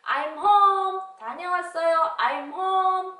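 high, loud homecoming call; child arriving home. Acted. Child greeting mom at the door, high loud call. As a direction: announce yourself in a ringing child's call.

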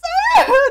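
short, very high laughing squeal; overwhelmed squeal. Real, not acted. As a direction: let out a high burst of emotion. Acts out overwhelming emotions.

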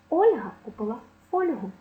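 Ольга and фольгу rhymed, ОЛЬга, ФОЛЬгу; first-syllable stress. Shown as a demonstration. Teacher giving a memory rhyme, stress on first syllable. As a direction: punch ОЛЬ in both Ольга and фольгу. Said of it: "наголос падає на перший склад".

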